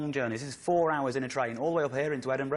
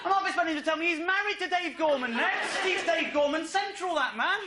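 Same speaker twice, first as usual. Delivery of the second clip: very high comic screech of a rant; outraged disbelief; 4 dB louder than usual. Acted. Comic erupting at the family tradition revelation, outraged disbelief. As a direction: echo it back at the top of your range, appalled.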